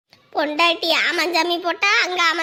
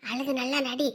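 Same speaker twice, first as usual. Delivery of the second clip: low, much softer conspiratorial murmur to the grandson; hushed scheming; 6 semitones lower, 9 dB quieter than usual. Acted. Grandmother secretly coaching her grandson, hushed and scheming. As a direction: keep it down so the father won't hear.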